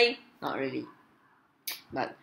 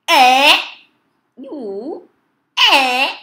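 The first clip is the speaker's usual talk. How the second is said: loud, high, stretched shouts; loud voice commands; 7 semitones higher, 12 dB louder, much slower than usual. Real, not acted. Player yelling commands at a voice-controlled game, shouting. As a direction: belt out short high calls.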